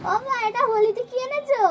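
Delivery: high, loud amazed ও মা; surprised. Real, not acted. Child unboxing a Holi gift, high and loud with amazement. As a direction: gasp it out in delight.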